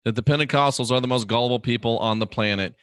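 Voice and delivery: ordinary delivery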